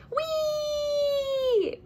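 high sliding whee; gleeful yell. Acted. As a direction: squeal down the pole. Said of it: "Callie yells as she glides down".

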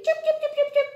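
high, quick birdie chirps; little bird chirping. Pretend. Teacher showing children a little birdie, high chirping. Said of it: "there's a little birdie".

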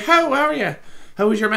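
high, loud leprechaun greeting; high-pitched comic leprechaun voice; leprechaun neighbour. Acted. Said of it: "my next door neighbor's a fucking leprechaun".

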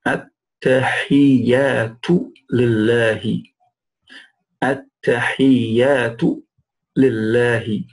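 slow, syllable-by-syllable At-tahiyyatu lillahi; model recitation. Shown as a demonstration. Teacher reading the Tahiyyat aloud for learners, slow model recitation. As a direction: draw out each long vowel in At-tahiyyatu lillahi. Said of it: "Let's read it".

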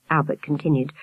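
plain reading voice

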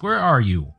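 gasped. Acted. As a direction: ask it taken aback. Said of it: "Pid gasped".

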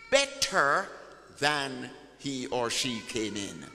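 ordinary talk, nothing marked

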